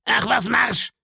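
high, harsh beggar cutting in; cruel cold command. A narrator's reading. Blind beggar cutting the boy off, cold and cruel. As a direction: bark the order to end the talk. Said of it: "unterbrach er mich".

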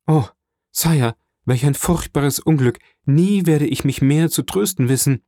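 acted despair, voice lifted. A narrator's reading. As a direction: cry out in dismay at a disaster. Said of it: "Einer übermächtigen Verzweiflung nachgebend".

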